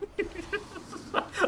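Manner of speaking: airy high-pitched laugh. Real, not acted. Streamer laughing at a chat reply, high laugh. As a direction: burst into a squeaky laugh.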